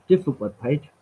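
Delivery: ordinary talk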